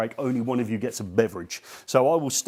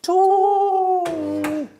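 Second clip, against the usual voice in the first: very high, loud, drawn-out falsetto TOOL; loud tool call; 6 dB louder than usual. Shown as a demonstration. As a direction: call it up to the ceiling, long and high. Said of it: "Okay, ready?".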